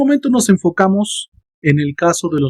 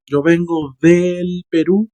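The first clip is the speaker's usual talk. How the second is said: "Yo vengo del Perú" slow, leaning on "del"; slow model; slower than usual. Shown as a demonstration. Teacher slowing down to show "del Perú". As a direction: spell out "del Perú" slowly. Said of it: "que es una contracción".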